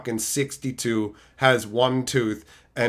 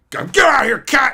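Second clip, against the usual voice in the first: shout at cat; 10 semitones higher, 8 dB louder than usual. Retold or imitated.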